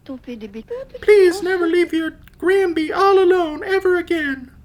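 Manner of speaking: put-on very high, sweet old granny; grandma voice. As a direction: squeak it out like a doting grandma. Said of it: "grandma voice".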